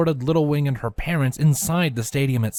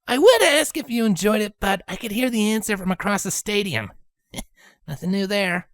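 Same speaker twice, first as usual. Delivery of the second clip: Rainbow Dash voice; 9 semitones higher than usual. Acted.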